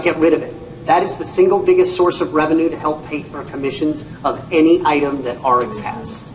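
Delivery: ordinary delivery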